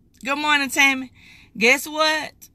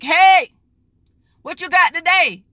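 bold call-out; 6 semitones higher, 6 dB louder than usual. Pretend. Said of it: "He wants you to be like".

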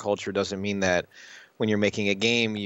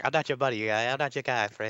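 South Park Canadian; 3 semitones higher than usual. Retold or imitated. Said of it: "just recreation of their culture".

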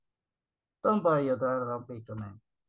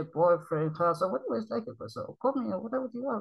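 usual speaking voice

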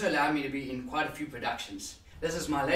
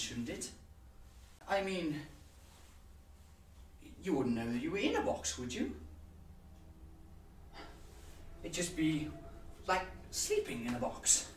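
softer, hesitant thinking aloud; musing monologue character; 2 semitones higher, 8 dB quieter than usual. Acted. Musing on being dead in a box, quietly uneasy. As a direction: quietly puzzle it out, with long pauses.

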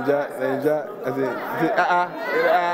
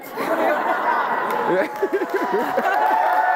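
real laugh at own joke. Real, not acted. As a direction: crack up at the punchline.

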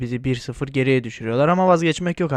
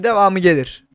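ordinary talk

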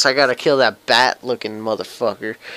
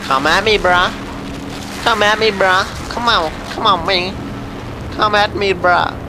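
taunting; 10 semitones higher than usual. Real, not acted. Taunting enemy in game.

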